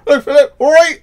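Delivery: high, loud Wiltshire yokel greeting; hammed-up Wiltshire yokel, up high and loud; mock Wiltshire accent. Brother mocking how his sister talked at school, in a loud high Wiltshire accent. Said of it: "You go".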